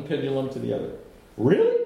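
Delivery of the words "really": squeaky high surprised Really; eager surprise. Retold or imitated. Peter suddenly eager to be washed all over, high surprise. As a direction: jump way up in delight.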